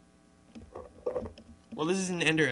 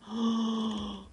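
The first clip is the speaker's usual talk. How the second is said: a startled high gasp; sharp high gasp; 8 semitones higher than usual. Real, not acted. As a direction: catch your breath in surprise. Egg appears, gasps.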